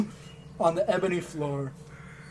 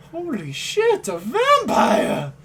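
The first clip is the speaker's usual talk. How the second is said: with a giant grin; 7 semitones higher, 6 dB louder, slower than usual. Acted. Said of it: "says with a giant grin".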